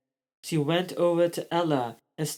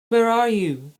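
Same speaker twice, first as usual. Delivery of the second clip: high, louder call for a friend; 7 semitones higher, 4 dB louder than usual. A narrator's reading. Ella calling out for her friend Rose, high call. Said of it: "she cried".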